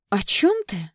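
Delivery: high, loud innocent question; wide-eyed pretend puzzlement; feigned innocence. A narrator's reading. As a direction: play dumb, batting lashes. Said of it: "захлопала ресницами Кэти".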